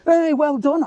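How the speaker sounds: high, bright mock cheer; high-pitched sarcastic "well done"; mock cheering stranger. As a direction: send up a stranger cheering along. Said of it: "started clapping their hands as well, like, cheering".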